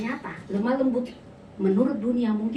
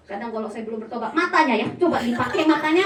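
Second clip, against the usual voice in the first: ordinary delivery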